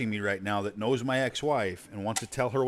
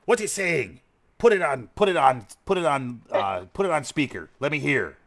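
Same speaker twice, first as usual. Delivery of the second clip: louder, higher acted orders; insistent, repeated commands, pitched up; impatient commander; 3 semitones higher, 5 dB louder than usual. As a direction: act out a commander demanding to listen. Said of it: "messaged back to Krug".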